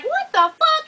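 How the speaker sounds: "What the fuck?" jumping way up in pitch; mock disbelieving squeal; shocked reaction. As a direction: act stunned by what you saw.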